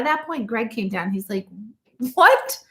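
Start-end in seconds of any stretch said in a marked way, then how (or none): 2.1-2.6 high-pitched incredulous burst; retold astonishment. Husband finds wife shouting at a bear, astonished. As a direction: imitate a baffled husband's outburst.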